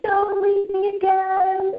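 high, drawn-out mock wail; imitating crying child. Son pleading at the back door as mom leaves, imitated tearful wail. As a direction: wail it slowly like a pleading little boy. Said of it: "crying and saying".

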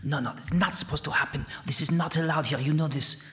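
fast, hushed objection; anxious dark voice. Acted. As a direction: protest quickly and softly from the shadows. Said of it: "voices echoing from the darkness".

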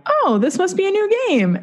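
put-on a two-year-old thrilled by a new game; high childish delight; excited toddler. Toddler's thought, eager.

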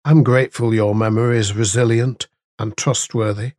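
low, hushed prayer. Acted. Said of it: "Let's pray".

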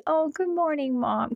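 tender morning greeting, imitating a child; loving reply. Children answering mom's morning welcome, lovingly. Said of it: "that's what everybody would say".

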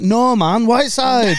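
a hint of Geordie lad; Geordie accent. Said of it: "that was a Geordie accent".

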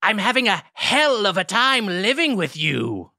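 high, slow ghoulish impression; Crypt Keeper. Pitching a pun to the roommate, high slow Crypt Keeper voice. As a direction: swoop through it like a punning ghoul. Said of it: "That impression that you just did".

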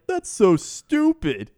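slow, high, loud put-on disgust; mock scorn. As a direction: pronounce it with hammed-up exasperation. Driver's acted scornful verdict.

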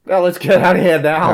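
high loud urgent plea; panicked. Acted. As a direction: urge escape in panic. Scared character urging flight.

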